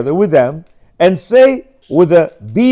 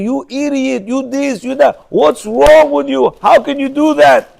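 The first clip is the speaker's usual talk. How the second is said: higher, angry yelling at a clumsy wife; mock screaming at wife; 6 semitones higher than usual. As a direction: scold her, pitched up and furious. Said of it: "now you're screaming".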